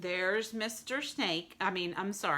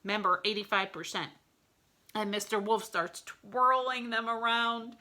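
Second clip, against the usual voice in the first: ordinary delivery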